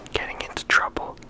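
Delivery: ordinary talk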